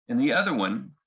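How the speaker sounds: ordinary delivery, nothing marked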